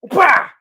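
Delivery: loud, high "Wah!" battle cry; mock attack yell. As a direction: leap in with a yell. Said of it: "he goes".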